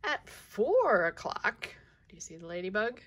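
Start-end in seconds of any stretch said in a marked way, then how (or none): none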